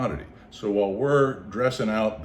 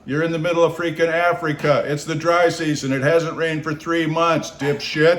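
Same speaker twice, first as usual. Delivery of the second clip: higher, louder exasperated scolding, slowing at the end; scolding inner voice; 8 semitones higher, 7 dB louder than usual. Retold or imitated. Inner voice scolding him awake, loud exasperated. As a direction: yell at yourself to wake up. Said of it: "this other voice says".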